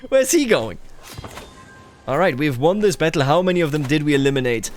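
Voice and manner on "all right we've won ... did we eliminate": ordinary delivery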